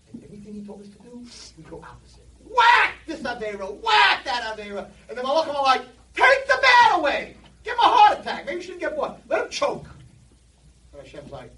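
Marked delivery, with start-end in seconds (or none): 2.4-9.9 outraged shout. Retold or imitated. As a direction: rail at God to punish him. Angels demanding punishment, shouting.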